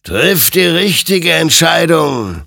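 high, loud, slow warning; stern admonition. A narrator's reading. Spectre warns the armed soldier, stern loud admonition. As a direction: admonish with drawn-out force. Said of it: "ermahnte ihn sein Gegenüber".

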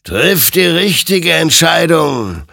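high, loud ghostly warning; admonishing spectre. A narrator's reading. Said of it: "ermahnte ihn sein Gegenüber".

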